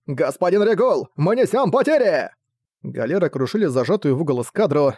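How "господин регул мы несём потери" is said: alarmed call. Acted. Sailor to commander Regul in battle, alarmed call. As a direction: call it out high and urgent.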